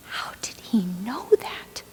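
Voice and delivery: slow, hushed disbelief; soft, wondering whisper; stunned whisper. Acted. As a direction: whisper it slowly in amazement. Stunned that her secret is known.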